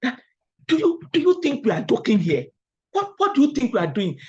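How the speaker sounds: mock the outraged editor scolding; angry editor voice reading a rejection aloud; nasty editor. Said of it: "the editor sent me a nasty email".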